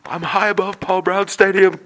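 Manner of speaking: high, loud traffic-chopper reporter; fake helicopter voice. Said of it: "our fake helicopter voice where we pat our chest".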